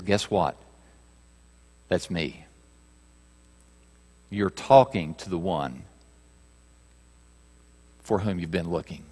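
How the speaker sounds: lightly hushed reverent reflection; hushed sermon voice. Acted. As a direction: draw them in close, quietly.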